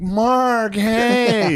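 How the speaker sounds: mock overjoyed welcome; gushing greeting. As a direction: greet him like a long-lost friend. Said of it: "he's like".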